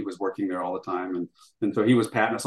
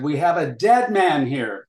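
urgent call; 5 semitones higher, 4 dB louder, slower than usual. Retold or imitated. Urgent call during taping.

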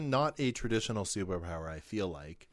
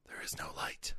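sinister breathy whisper; 10 dB quieter than usual. Pretend. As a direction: hiss it like a villain. Dark sponsor whispering.

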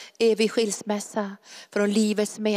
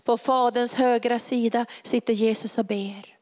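ordinary talk, nothing marked

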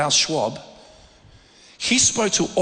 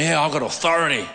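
cocky younger self. Retold or imitated. Mocks his cocky younger self.

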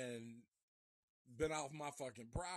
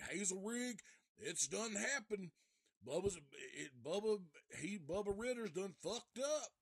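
higher, urgent mock holler; urgent retold alarm; 6 semitones higher than usual. Harless running into the post office with bad news, urgent. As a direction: retell a breathless man bringing bad news.

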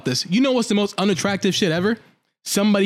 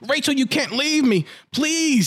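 higher, hammed-up whiny begging; mock whiny plea; 8 semitones higher, slower than usual. Clingy guy begging Rachel to stay, mock whiny. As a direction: beg like a clingy fool. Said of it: "his stupid ass like".

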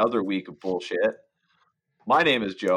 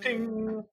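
mouth bell ding; 10 semitones higher, 8 dB quieter than usual. Acted. Bell sound for next number.

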